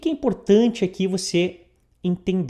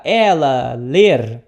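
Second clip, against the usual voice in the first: mock literal question; 5 semitones lower, 5 dB louder, much slower than usual. Shown as a demonstration.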